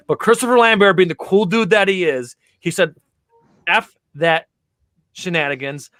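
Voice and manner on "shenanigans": ordinary talk, nothing marked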